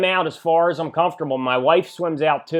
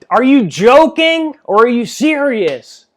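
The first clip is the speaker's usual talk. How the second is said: high, loud call across the lake; yelling across water; 10 semitones higher, 8 dB louder than usual. Retold or imitated. Calling out to the stranger far off in the lake, loud high yell. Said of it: "And I yell out to him".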